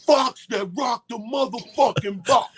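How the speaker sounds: high, slow shouted rap line; mock hip hop hype shout; thuggish rapper. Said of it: "most thuggish motherfucker say".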